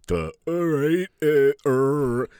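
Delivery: slow, dopey voice of a clueless guy; imitating his clueless self; oblivious dope. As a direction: play dumb and slow. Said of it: "I'm oblivious".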